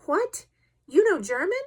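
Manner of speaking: incredulous high-pitched question; astonished cry. A narrator's reading. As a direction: exclaim it in disbelief, pitch jumping up. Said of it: "I cried".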